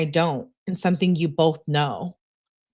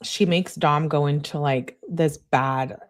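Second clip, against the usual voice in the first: usual speaking voice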